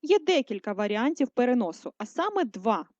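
usual speaking voice

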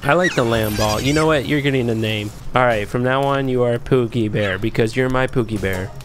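ordinary delivery

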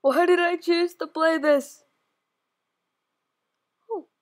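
ordinary delivery

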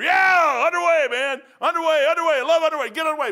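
high, loud whooping cheer; gleeful shouting. Retold or imitated. Happy sailor cheering while the ship is miserable, gleeful shout. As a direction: holler it out in exaggerated delight.

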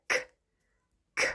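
"k" as a bare voiceless consonant; letter K sound. Shown as a demonstration. Teacher answering what K says, isolated voiceless "k". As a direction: click out "k" alone, pausing between. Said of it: "The K says".

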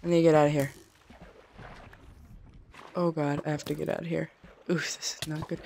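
ordinary delivery

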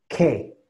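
"Que" as an emphatic first syllable; strong rising stress. Shown as a demonstration. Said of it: "empezar con un tono fuerte".

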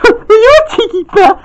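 high sing-song tease; high teasing voice. Acted. Girl voice teases.

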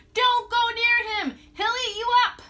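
loud, high frantic warning; people yelling. Retold or imitated. Townsfolk warning the boy away, loud yell. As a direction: stop the boy and father. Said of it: "People yelled".

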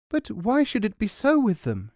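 high, eager questioning woman; eager village woman. Acted. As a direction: ask like a kind, simple wife. Woman pleads for in-laws.

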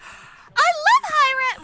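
high, slow, sugary fan voice; mock gushing. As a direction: gush over the company in a high, slow squeal. Sucking up to the company.

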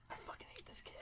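hushed, under-the-breath whisper; whispered grumble. Retold or imitated. Manager muttering about the server as he walks away, whispered. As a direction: say it so only you can hear. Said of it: "and he was like".

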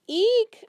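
high, loud hamster eek; squeak. Acted. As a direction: let out a shrill squeak. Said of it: "I squeaked".

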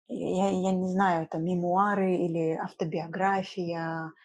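normal talking voice

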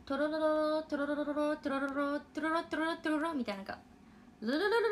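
トロロロロ with a trilled r; rolled r. Shown as a demonstration. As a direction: trill the r through トロロロロ and ルルルルルル. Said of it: "これで上向いて".

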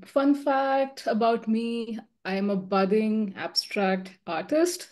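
normal talking voice

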